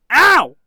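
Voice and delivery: sharp high pained yelp. Shown as a demonstration. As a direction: cry out as if slapped. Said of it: "That's what I sound like".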